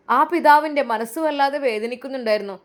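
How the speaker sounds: narrating the father's heartache; story narration. A narrator's reading. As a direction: tell the listener the father's pain. Narrator comments.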